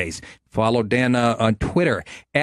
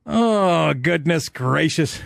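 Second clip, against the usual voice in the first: ordinary delivery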